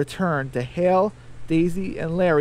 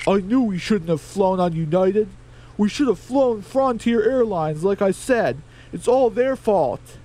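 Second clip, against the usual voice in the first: higher, altered grumbling chicken voice; 5 semitones higher, 4 dB louder than usual. Acted. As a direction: whine a bit higher about the airline. Larry voiced in story.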